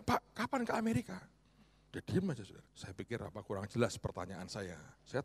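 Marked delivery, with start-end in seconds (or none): 0.0-1.1 much higher, eager, a bit louder; eager question. Retold or imitated. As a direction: chirp the question up high. Pastor asks friend, retold.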